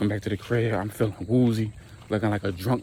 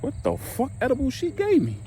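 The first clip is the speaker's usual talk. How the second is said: incredulous. Retold or imitated. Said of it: "I'm like".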